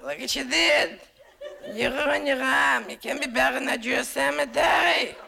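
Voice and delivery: aunt scolding her nephew over his tattoo, high and wailing. Retold or imitated. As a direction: wail at him like a scandalized aunt.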